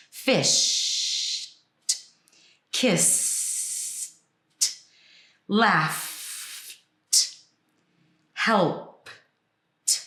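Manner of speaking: word, long final sound, separate puffed T; unvoiced T endings. Shown as a demonstration. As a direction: stretch each last consonant, then a detached voiceless T. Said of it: "there's also no voice on the E D".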